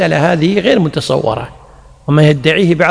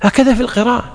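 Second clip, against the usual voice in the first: ordinary talk, nothing marked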